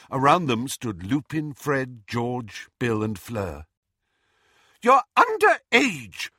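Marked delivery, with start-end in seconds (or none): 4.8-6.3 high, loud motherly shout; mother yelling at her underage daughter. A narrator's reading. Mother shouting at her daughter, loud and high. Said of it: "Missus Weasley shouted at her daughter".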